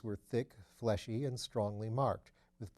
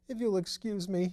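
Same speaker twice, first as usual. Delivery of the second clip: faint murmur; 9 semitones higher, 5 dB louder than usual. Acted. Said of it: "he murmured".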